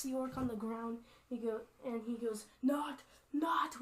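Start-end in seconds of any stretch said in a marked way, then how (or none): 2.6-3.8 higher, urgent two-word plea; mild orc plea. Acted. Orc on the ground begs the Highlander, mild urgent plea. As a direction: call it out to stop the blow.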